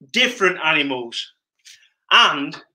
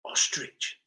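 whisper; 12 dB quieter than usual. Real, not acted. Whispered aside.

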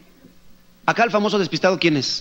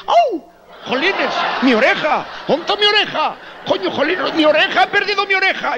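much higher, hammed-up howling in pain; mock pain yelling; 11 semitones higher than usual. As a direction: wail like he just lost an ear. Worker lost his ear.

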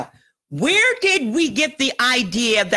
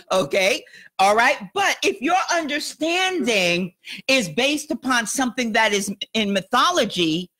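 ordinary talk

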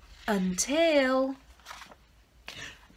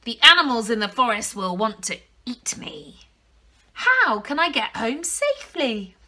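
granny voice; 2 semitones higher, 8 dB louder than usual. A narrator's reading. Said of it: "she said".

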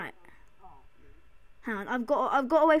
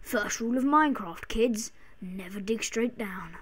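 mock lesson whisper; 4 semitones lower, 6 dB quieter than usual. Mock advice to kids, whispered.

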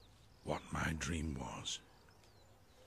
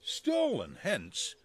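high startled cry; 5 dB louder than usual. Acted. Bottom waking, crying out.

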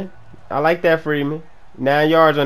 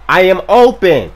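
loud, higher, emphatic call; insistent shout; 4 semitones higher, 10 dB louder than usual. Real, not acted. Player calling for the ball, insistent shout.

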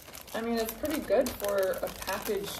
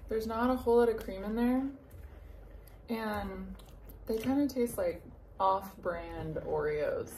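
speaking between bites; chewing while talking. Real, not acted. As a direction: mouth partly full. Seen 1: bites cookie at -2, mouth working while speaking, cookie in hand.

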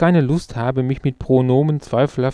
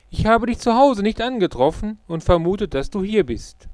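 put-on old friend's markedly higher voice; Luke; 8 semitones higher than usual. A narrator's reading. Old schoolmate explaining how he found his friend, higher character voice. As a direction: speak up high as the chatty schoolmate.

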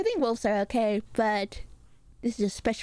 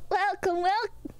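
high, drawn-out mimicked welcome; stretched high impression of her welcome; imitating her greeting; 8 semitones higher, slower than usual. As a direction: copy her slow, high-pitched hello. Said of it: "That is exactly how she says it".

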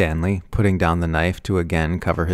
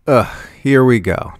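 loud, weary groan of annoyance; here-it-comes exasperation; exasperated sigh; 6 semitones higher, 6 dB louder than usual. A narrator's reading. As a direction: sigh it out, fed up. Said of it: "Tommy sighed".

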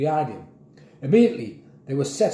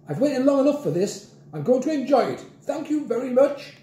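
put-on high-pitched, haughty old lady voice; reply loftily in a high voice; huffy old lady; 11 semitones higher than usual. A narrator's reading. Mrs Trifle refusing to be hurried, high and huffy.

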